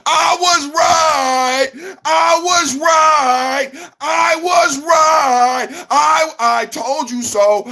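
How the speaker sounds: high, slow, stretched gloating yell; triumphant shout. Real, not acted. Streamer gloating to his chat, stretched shout. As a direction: crow it over and over. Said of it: "I just wanna say to everybody".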